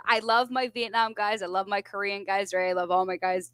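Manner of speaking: ordinary talk, nothing marked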